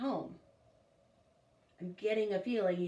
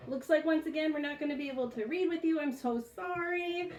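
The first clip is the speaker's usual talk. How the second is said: higher gentle coo; cooing apology; 7 semitones higher than usual. Real, not acted. Mother holding baby, apologizing.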